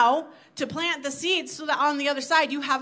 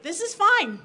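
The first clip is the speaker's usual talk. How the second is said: breezy higher-pitched self-reassurance; mock complacency; 6 semitones higher, 4 dB louder than usual. Acting out her complacency.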